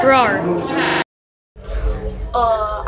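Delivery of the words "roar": a low, soft play roar at the tiger; mock tiger roar. Vlogger playfully roaring back at a tiger behind glass, low mock roar. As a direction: growl back at the big cat, half in fun.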